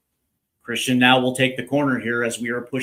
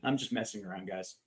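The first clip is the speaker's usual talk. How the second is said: quiet aside; 5 semitones lower, 15 dB quieter, faster than usual. Real, not acted. Aside after taunting Germany.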